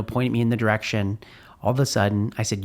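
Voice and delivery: usual speaking voice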